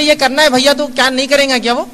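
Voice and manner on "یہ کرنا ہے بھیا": a sister's tearful, pleading ask, raised in pitch; imitating a crying sister begging her brother; higher, pleading sister's voice with a hint of tears; mock tearful plea. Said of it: "ایک بہن روتے روتے بھائی کو بولے".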